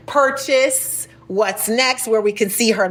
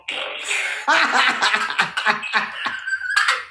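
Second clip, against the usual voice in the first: laughing; 7 semitones higher, 3 dB quieter than usual. Real, not acted. Host laughs at own joke.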